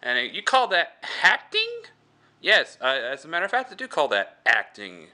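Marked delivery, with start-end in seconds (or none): 0.4-1.8 high, loud, scornful director; Crypt Keeper. Acted. Director berating his actor on set, high scornful screech. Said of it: "is director, and he goes". 2.4-5.1 indignant retort, far less shrill; defensive actor. Acted. Actor answering the director's insult, defensive retort.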